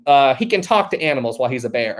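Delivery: normal talking voice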